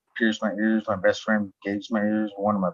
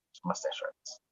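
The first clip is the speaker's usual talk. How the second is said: very soft, nearly voiceless aside; hushed throwaway joke; under the breath; 13 dB quieter than usual. Real, not acted. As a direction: slip the joke out almost whispered.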